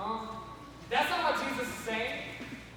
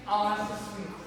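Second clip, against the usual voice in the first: ordinary talk, nothing marked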